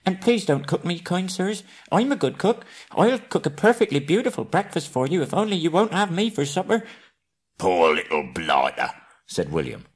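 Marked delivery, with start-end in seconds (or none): 0.1-6.8 high, squeaky begging hobbit voice; pleading hobbit. Acted. Bilbo begging the trolls not to cook him, high. 7.6-8.9 lightly voiced pitying troll. William troll pities Bilbo.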